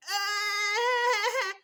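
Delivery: put-on high, loud wailing cry of a baby in pain; hysterical baby cry. Shown as a demonstration. Mother showing mothers a hysterical colic cry, loud high wail. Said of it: "One which goes hysterical".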